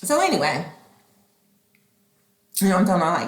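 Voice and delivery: ordinary delivery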